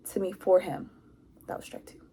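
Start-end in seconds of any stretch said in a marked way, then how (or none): 1.5-2.0 very soft, almost voiceless verdict; barely audible side remark; hushed aside. Real, not acted. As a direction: drop it to a near-whisper, as an aside.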